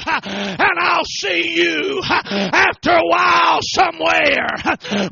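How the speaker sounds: loud, rising, slow-dragged preacher cadence; building to a shouted sermon peak; preaching at full force. Acted. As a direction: hammer it home like a revival preacher. Preaching climax, death story.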